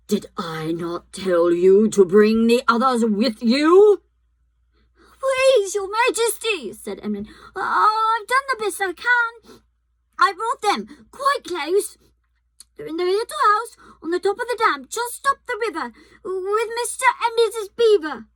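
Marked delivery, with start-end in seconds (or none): 0.1-4.0 louder, harsh queen's anger; terrible voice. A narrator's reading. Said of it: "in a terrible voice". 5.2-6.7 pleading. A narrator's reading. Frightened Edmund pleads with witch. 7.6-18.3 nervous pleading. A narrator's reading. Frightened Edmund pleads with witch.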